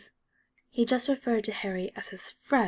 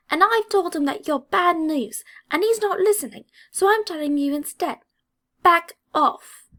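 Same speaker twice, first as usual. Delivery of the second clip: loud, much higher angry demand; angry warning; 9 semitones higher, 7 dB louder than usual. A narrator's reading. Angry redhead orders Draco to back off, loud and furious. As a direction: lay down the final warning. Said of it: "the angry Ginger".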